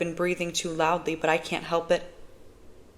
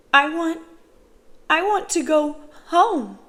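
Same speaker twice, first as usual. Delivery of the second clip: put-on high, halting child's plea; tearful hiccuping; 10 semitones higher, 6 dB louder than usual. A narrator's reading. Crying girl tells social worker she wants home, tearful. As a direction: plead through tears, much higher and louder, breaking between phrases. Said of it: "I'm suddenly hiccuping".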